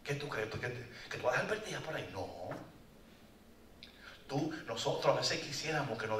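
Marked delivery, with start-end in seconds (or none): 0.0-2.1 soft, low, gentle voice of God; tender reassurance. Pretend. God to the ashamed sheep, soft and tender. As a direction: reassure her softly.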